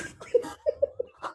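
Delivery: airy, high-pitched wheeze of a laugh; cracking up at her own joke; high breathless laugh. Real, not acted.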